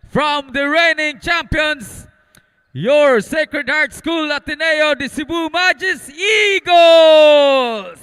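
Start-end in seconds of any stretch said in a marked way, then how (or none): 2.7-8.0 slow, booming champion intro; hype shout. Acted. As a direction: build it up and roar the team name. Announcer hyping home champions.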